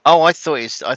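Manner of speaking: sudden high, loud burst of realisation; real surprise. Real, not acted. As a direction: caught off guard by her own mistake. Realises she misread Cumbria.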